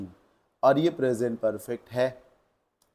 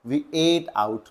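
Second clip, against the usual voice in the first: slow corrected phrase; 2 semitones higher, much slower than usual. Shown as a demonstration. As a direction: spell out the fixed words slowly. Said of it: "इसको सही करते हुए". (धीमे, साफ़ सुधार)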